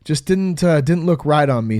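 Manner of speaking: ordinary talk, nothing marked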